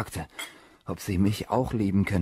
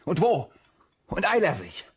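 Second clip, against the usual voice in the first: high, louder pressing command; impatient urging; 10 semitones higher, 5 dB louder than usual. Acted. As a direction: hurry him along. Treibt Diener ungeduldig an. (ungeduldig drängend)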